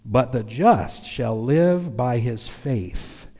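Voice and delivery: slow, stretched-out scripture reading in imitation; drawn-out preacher impression, lingering on each phrase; J Vernon McGee. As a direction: imitate an old radio Bible teacher intoning a verse. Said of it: "I can hear him saying it".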